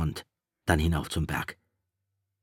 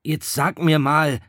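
higher, louder and slow, anxious; worried; 10 semitones higher, 8 dB louder, slower than usual. A narrator's reading. Piggy asking Ralph anxiously about the signal. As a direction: press him with rising worry. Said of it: "fragte Piggy besorgt".

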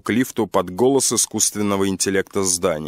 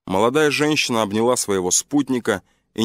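ordinary talk

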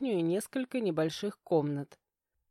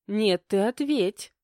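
louder, slow insistent retort; stubborn demand; 3 semitones higher, 6 dB louder, slower than usual. A narrator's reading. Wife to husband who hushes her, stubbornly insisting. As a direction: refuse to be hushed. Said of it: "зашипела я упрямо".

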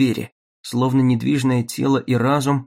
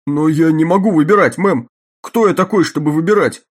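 pleading guard; 5 semitones higher, 6 dB louder than usual. Acted. Guard pleading, unable to choose.

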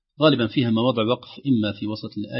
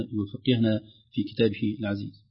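ordinary talk

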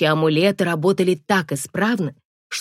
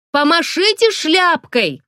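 calling out; 11 semitones higher, 4 dB louder, slower than usual. A narrator's reading. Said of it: "предложила я".

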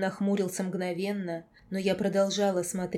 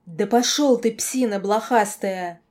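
furious outburst; 9 dB louder than usual. A narrator's reading. Girl losing it at the werewolf lord, furious outburst. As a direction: snap and hurl the insult loudly. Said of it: "И вот тогда я сорвалась".

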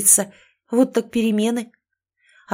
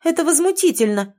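indignant; 9 semitones higher, 4 dB louder than usual. Acted. Indignant outburst at meeting.

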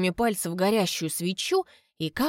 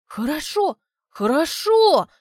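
eager; 7 semitones higher, 5 dB louder, slower than usual. Acted. Albina eagerly agrees.

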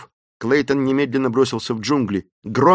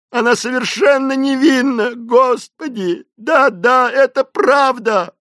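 very high, loud wailing plea; wretched cry; 11 semitones higher, 5 dB louder than usual. A narrator's reading. Terrified Esmeralda pleading with God, crying out wretchedly. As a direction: lament to heaven, stretching the words. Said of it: "закричала несчастная женщина".

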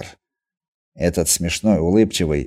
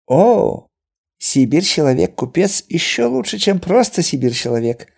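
Chinese merchant voice; 8 semitones higher than usual. A narrator's reading. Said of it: "резюмировал иноземец".

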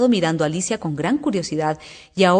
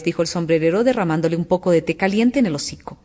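plain reading voice

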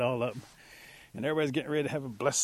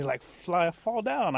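earnest excuse; 7 semitones higher, 4 dB louder, much slower than usual. Acted. Little creature explaining its wings to the animals, earnest excuse. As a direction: pitch lifting high on the excuse.

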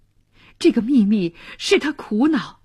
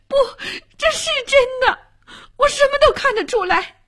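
high-pitched, distraught protest; vehement insistence. A narrator's reading. Girl contradicts the man's denial, high and vehement. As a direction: push back hard, voice pitched right up.

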